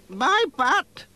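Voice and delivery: put-on old lady voice. A narrator's reading. Old lady says goodbye.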